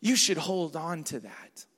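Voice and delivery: low, hushed insinuation; stranger's tempting voice. Acted. Preacher voicing the stranger feeding a grudge, low and hushed. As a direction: softly egg them on, pitch dropped. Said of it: "The stranger's voice comes".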